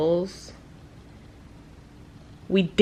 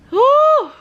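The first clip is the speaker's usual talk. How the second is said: whew; 8 dB louder than usual. Retold or imitated. Recalling a moldy hotel.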